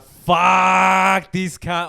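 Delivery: drawn-out yelled curse; reenacted road-rage outburst, loud and long; retold furious shout. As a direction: bellow it, stretching the first word right out. Outburst while punching the wheel.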